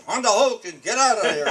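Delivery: loud, high shouted command; a retold shouted order, half laughing; barked order. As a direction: order the soldier out of the foxhole. Orders German soldier out of foxhole.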